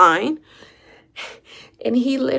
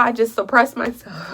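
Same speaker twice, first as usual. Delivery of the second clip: ordinary talk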